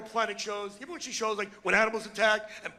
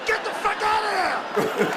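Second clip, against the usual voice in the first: loud, much higher yell; yelled warning; 11 semitones higher, 5 dB louder than usual. Acted. Comic shouting at husbands to run from the wife. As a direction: scream it as a panicked warning.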